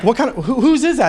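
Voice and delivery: mock-puzzled question, rising high. Buddy's friends asking about his barbecue rub, puzzled.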